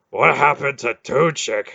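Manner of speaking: breathless question; out of breath. Acted. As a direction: ask while still gasping. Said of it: "still gasping for breath".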